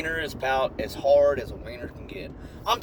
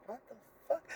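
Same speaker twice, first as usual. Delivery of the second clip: stunned hush; 7 semitones higher, 13 dB quieter, faster than usual. Acted. Stunned reply.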